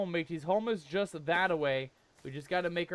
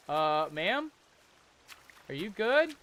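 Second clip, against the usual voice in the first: ordinary talk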